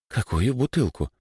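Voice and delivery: high, confused question; bewildered. Acted. Boy is told to get a bottle, bewildered. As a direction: ask as if completely lost. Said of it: "растерялся Димыч".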